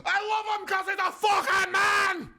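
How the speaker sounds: very high, loud comic yell; mimicked shouted outburst. Bashful pub regular finally nudged on camera, mimicked shouted outburst. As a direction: burst out bellowing like the nudged drunk.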